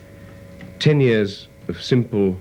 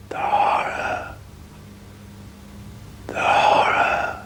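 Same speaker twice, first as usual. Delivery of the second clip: slow, voiceless whisper of dread; 4 dB quieter, much slower than usual. Acted. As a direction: breathe it out in horror. Famous film line, whispered.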